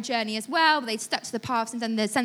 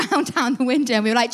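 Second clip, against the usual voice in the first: normal talking voice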